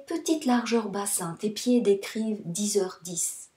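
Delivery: usual speaking voice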